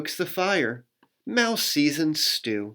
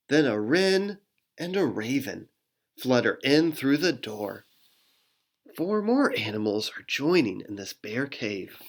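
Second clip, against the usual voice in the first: ordinary delivery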